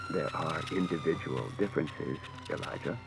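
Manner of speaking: low, soft, even android voice; measured, unhurried humanoid robot; calm robot voice. A narrator's reading. Robot answers evenly.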